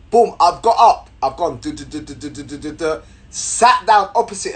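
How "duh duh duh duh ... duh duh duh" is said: quiet rapid "duh-duh-duh" patter; imitated scurrying steps. Storyteller miming crossing the carriage, soft patter. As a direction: patter softly like quick feet.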